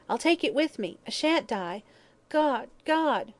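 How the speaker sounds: high, desperate, wailing plea; whimpering. A narrator's reading. Dying hag clawing at her gold, whimpering. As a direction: voice lifted in desperate fear.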